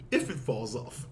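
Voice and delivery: very low, soft answer; calm reply. Retold or imitated. Husband answering his angry wife, low and calm. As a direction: drop low and quiet, unruffled.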